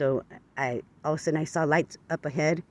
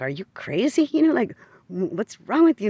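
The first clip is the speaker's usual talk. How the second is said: incredulous; 6 semitones higher, 5 dB louder than usual. Retold or imitated. Staring at neighbor in disbelief.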